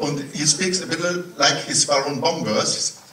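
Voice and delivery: ordinary talk, nothing marked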